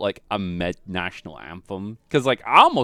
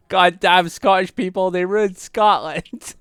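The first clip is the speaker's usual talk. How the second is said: loud, high cartoon Scottish rant; hammed-up angry Scotsman impression; Willy from The Simpsons; 10 semitones higher, 6 dB louder, slower than usual. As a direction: rail at them in an outraged Scottish voice, drawing out the end. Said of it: "I'm like Willy from, uh, The Simpsons".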